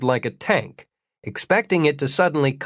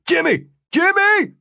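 high, urgent yell; calling out loudly across a distance; 5 dB louder than usual. A narrator's reading. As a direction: shout the name to get his attention. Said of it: "saw what he was looking for and yelled".

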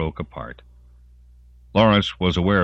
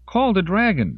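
urgent cry; 4 dB louder than usual. A narrator's reading. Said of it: "Denise said".

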